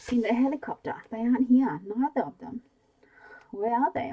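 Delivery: shouting. A narrator's reading. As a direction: bark the questions at him. Narrator voices shouted interrogation.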